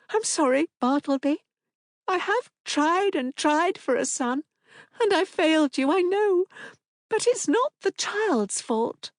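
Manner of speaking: high, pleading woman; an apologetic wife near tears; distressed mother. A narrator's reading. Wife apologising to her husband, high and pleading.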